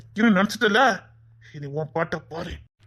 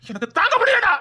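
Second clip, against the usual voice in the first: crying out; 9 semitones higher than usual. Acted. Wife watching her husband beaten, crying out. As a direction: cry out that she can't bear it. Woman distressed watching beating.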